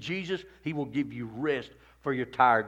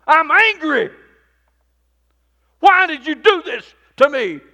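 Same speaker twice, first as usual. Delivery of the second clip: loud, high angry protest to God; 14 semitones higher, 16 dB louder than usual. Retold or imitated. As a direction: hurl the complaint upward. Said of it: "I told him I'm mad at him".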